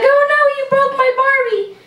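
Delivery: high, whiny little-sister voice. Retold or imitated. Imitating sister upset about her broken Barbie, high whine. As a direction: squeak it like a bratty little sister.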